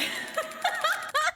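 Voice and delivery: very high, loud laugh; shrieking laugh. Real, not acted. Cracks up at the speech.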